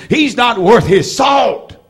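he's not worth his salt, shouted out slowly; preaching shout. Real, not acted. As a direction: hammer it home to the room. Preacher calling out to congregation.

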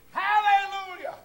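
very high, held hallelujah cry; exultant whoop. Real, not acted. Worshipper who doesn't care who looks, exultant whoop. Defies worry about being too loud.

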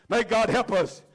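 ordinary talk

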